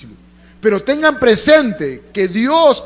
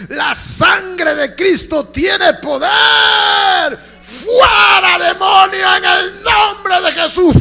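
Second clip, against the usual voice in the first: slow, much louder and higher commanding shout; rebuking shout; 8 semitones higher, 6 dB louder, much slower than usual. Retold or imitated. Preacher reenacting his rebuke of the demon, slow shouted command. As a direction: cast the demon out at full voice.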